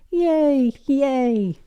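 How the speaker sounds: high gleeful yay; cheering. Acted. Pepper and Susie cheering, high and gleeful. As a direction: cheer like little kids.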